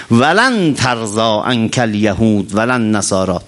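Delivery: slow, low chanted verse; low slow recitation of the verse; Quran recitation. Acted.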